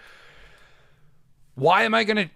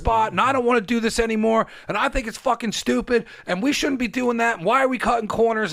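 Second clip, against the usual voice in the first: higher pitched, worked-up rant to a friend; fired-up rant; 6 semitones higher than usual. Retold or imitated. Calling Gina from the car, higher and all worked up. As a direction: blurt it all out, fired up and swearing. Retelling excited call to Gina.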